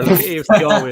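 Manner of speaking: A F C always read out loudly and high, with the C punched; reading a comment out loud. Shown as a demonstration. Host reads a fan comment aloud, loud and lively. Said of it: "I'm just looking in the comments there".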